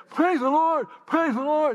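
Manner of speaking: excited, high imitation of cleft-palate speech. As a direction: cry it out high with blurred consonants. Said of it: "She had a cleft palate. And she talked like this".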